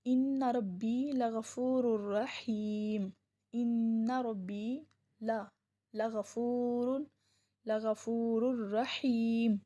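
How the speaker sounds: slow, melodic recitation; a teacher reciting the verse slowly in a chanting tone; Quran recitation. Shown as a demonstration. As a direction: recite it slowly, drawing each word out with devotion. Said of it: "اللہ تعالیٰ نے ارشاد فرمایا".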